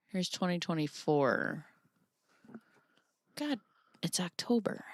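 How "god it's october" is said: whispered. Real, not acted. Muttering while scrolling videos.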